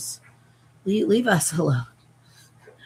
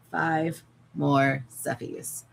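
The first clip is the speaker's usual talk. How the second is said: "Five more Seffies" stretched slowly, "more" drawn long; 4 semitones lower, 3 dB quieter, much slower than usual. Real, not acted. Counting her remaining plushies to viewers, slow and drawn out. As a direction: drag the count out wearily.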